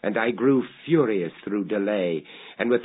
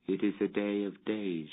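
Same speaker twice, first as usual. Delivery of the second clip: dying woman's voice; 6 dB quieter than usual. A narrator's reading. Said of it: "she said as I approached".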